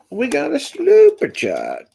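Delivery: calling out. Pretend. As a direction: sing out the call, way up high and stretched. Calling out a chat announcement.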